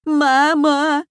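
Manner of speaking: high, loud, drawn-out cry for mother; panicked squeal. A narrator's reading. Frightened boy calls his mother, high squealing yell. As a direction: scream for mum like a frightened boy. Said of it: "заверещал Коля".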